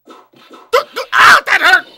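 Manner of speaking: very high, loud shout of pain; pained yelp. Acted. Player voicing a character who got hit, pained shout. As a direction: yelp it out in acted agony.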